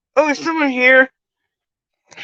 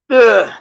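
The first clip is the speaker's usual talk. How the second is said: pained groan; 4 semitones higher, 10 dB louder than usual. Real, not acted. Real pain after injury.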